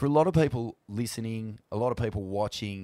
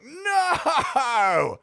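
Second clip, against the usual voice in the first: anguished no; 5 dB louder than usual. Retold or imitated. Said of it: "I was like".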